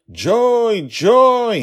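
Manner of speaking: very high, loud, sustained yells; searching father yelling for his daughter. A narrator's reading. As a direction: yell her name with growing desperation. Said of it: "He never stopped yelling".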